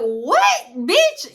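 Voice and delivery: high, loud shocked what bitch; outraged disbelief at her friend. Retold or imitated. Shocked reaction in retold scene.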